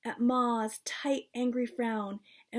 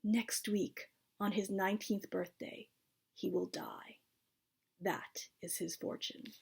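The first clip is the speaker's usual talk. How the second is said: fading from low to a whisper; grim prophecy; 3 semitones lower, 9 dB quieter than usual. A narrator's reading. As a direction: let the doom sink away into whisper.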